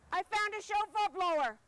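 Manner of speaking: gasp, then blurt out the good news; thrilled at finding the blower; excited gasp. Acted. Finding the missing shofar blower.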